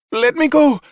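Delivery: high, loud frantic shout; desperate shout at the soldiers. A narrator's reading. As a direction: scream to be let go. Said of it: "Xi shouted".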